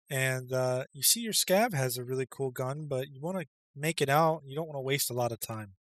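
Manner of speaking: ordinary delivery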